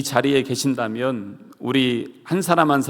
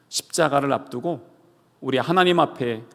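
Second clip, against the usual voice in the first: normal talking voice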